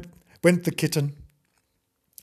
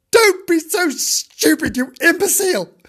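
exasperated scolding; 14 semitones higher, 7 dB louder than usual. Acted. Dog calling the kitten stupid, exasperated. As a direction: berate the kitten in hammed-up exasperation.

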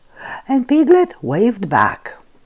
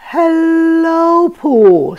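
high squeaky little voice; 10 semitones higher, 5 dB louder, much slower than usual. A narrator's reading. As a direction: squeak it like a tiny piglet. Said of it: "squeaked Piglet".

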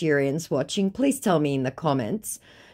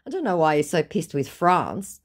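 ordinary talk

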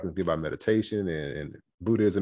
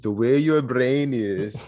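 slow, higher imitation of a teacher's accent; Miss Saeed's accent; 4 semitones higher, 4 dB louder, much slower than usual. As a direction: mimic the teacher's foreign accent, stretched and slow. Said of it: "I always laugh and I do my accent".

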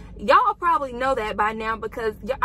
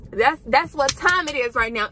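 ordinary talk

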